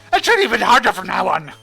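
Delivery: lightly voiced loony character; a hint of a goofy loud voice; Ripperoo. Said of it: "imagine her talking a bit like, uh, Ripperoo".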